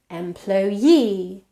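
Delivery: "employee" stressed on the final -ee; stress on last syllable. Shown as a demonstration. Teacher showing suffix stress to learners, "employee" stressed on its long final -ee. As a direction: land firmly on the end of "employee". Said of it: "has the stress on the suffix".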